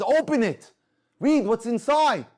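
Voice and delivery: loud, high, drawn-out order; insistent driver's shout, retold; urgent command. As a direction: demand it at full voice, stretching the words. Driver urges him to open the note.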